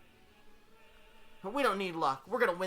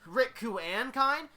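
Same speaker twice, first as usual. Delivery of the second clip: pitched up in questioning surprise; higher, doubting repeat ending on a rise; quizzical echo; 7 semitones higher, 3 dB louder, slower than usual. Acted. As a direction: echo the names with raised eyebrows. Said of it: "[name] raises eyebrows a bit at the question".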